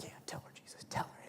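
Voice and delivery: whispered urging; eager whisper. Retold or imitated. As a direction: egg him on under your breath. Disciples egging Jesus on.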